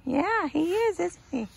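high, soft coo to a dog; high sing-song doggy talk; doting pet talk. Real, not acted.

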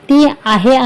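ordinary delivery

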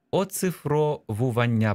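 slow, оцифровування broken into syllables; syllable-by-syllable dictation. Shown as a demonstration. Teacher dictating a long word, slow separated syllables. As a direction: spell it out by sound, slowly.

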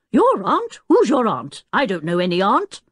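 loud, incredulous outburst; angry professor's bellow; indignant roar. A narrator's reading. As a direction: roar the questions back in disbelief. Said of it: "roared the Professor".

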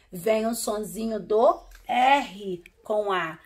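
ordinary talk

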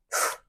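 short voiceless "ф" of air; quick airy "ф" sound; regional breath yes. Shown as a demonstration. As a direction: answer yes with just a whoosh of breath. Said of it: "в деяких регіонах так відповідають".

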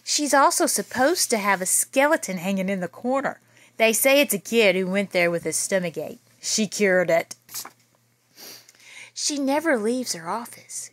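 lively read-aloud storytelling voice; story narration. A narrator's reading. Teacher reading a spooky school story to children, lively. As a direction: read it out big and lively for the kids. Reads picture book to kids.

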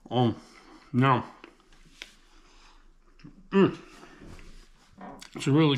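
chewing while talking; muffled eating mumble; mouth full of pizza. Real, not acted. Seen 1: bites pizza at 2.1-2.6, then chews while speaking.

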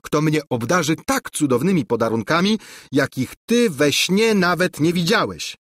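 high, taunting princess voice; haughty princess. Acted. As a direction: sneer it like a spoiled princess. Angelika's taunting quote.

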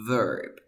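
"verb" said with an E vowel. Shown as a demonstration. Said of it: "como si fuera una E".

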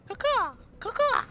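high mock crow caw; hammed-up crow call; bird call. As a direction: squawk it like a crow. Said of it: "Fucking bird call".

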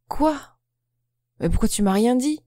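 startled high cry of alarm. A narrator's reading. Boyfriend learns he missed her birthday, alarmed cry. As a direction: jolt up in pitch, caught off guard. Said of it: "s'alarme-t-il".

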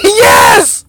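high, loud victory cry; triumphant shout. Real, not acted. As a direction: burst out in relief and triumph. Catch succeeds after begging.

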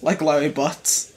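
normal talking voice

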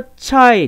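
チャイ with a full, drawn-out "ai" diphthong; ai sound. Shown as a demonstration. Teacher contrasting child with children, チャイ with a clear ai. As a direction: stretch チャイ slowly to show the ai. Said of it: "アイって音だったけど".

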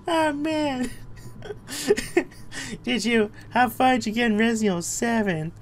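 high cartoon whine; squeaky put-on voice. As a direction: play it as a cartoon sidekick. Same put-on voice continues.

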